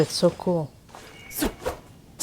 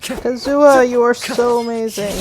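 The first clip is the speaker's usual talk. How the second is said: loud, higher-pitched fan gushing; gushing praise; 6 semitones higher, 6 dB louder than usual. Real, not acted. Player praising Kazuha in the game, gushing and excited. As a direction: gush with loud admiration.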